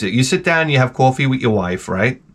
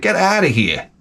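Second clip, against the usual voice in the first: mock disbelief, pitch jumping higher; half-hammed disbelieving outburst; incredulous scoff; 10 semitones higher than usual. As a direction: scoff like it's beneath you. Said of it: "I'm like".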